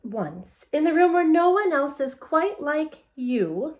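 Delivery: put-on picture book read to kids; story reading. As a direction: read the book to children with expression. Story read-aloud resumes.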